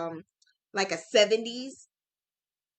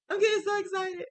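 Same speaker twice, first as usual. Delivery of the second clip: much higher, a bit louder gush of excitement; excited squeal; 10 semitones higher, 3 dB louder, faster than usual. Real, not acted. Crafter telling viewers about her rug plan, squealing with excitement. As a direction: let the excitement burst out in a high squeal.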